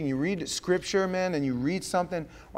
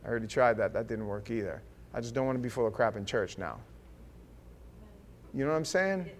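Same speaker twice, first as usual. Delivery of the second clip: usual speaking voice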